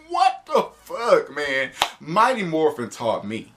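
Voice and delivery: usual speaking voice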